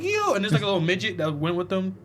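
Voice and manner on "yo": high startled yo; shocked yo. Retold or imitated. Recalling watching the movie, high shocked exclamation. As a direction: jump up in pitch in disbelief. Own reaction acted in retelling.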